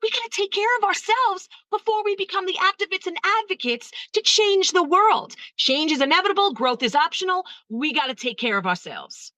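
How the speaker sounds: rally them like a civil rights activist; lightly voiced rallying call; activist sisters.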